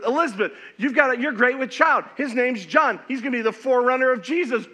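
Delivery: loud and fast, high joyful news; excited announcement. Retold or imitated. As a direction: spill out the good news. Angel announcing good news.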